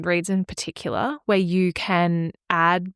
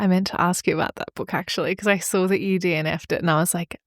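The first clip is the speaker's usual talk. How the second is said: ordinary talk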